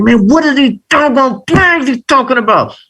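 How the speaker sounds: high-pitched exasperated retelling; frustrated. Retold or imitated. Student baffled by his mentor's flags, frustrated. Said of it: "But I was frustrated".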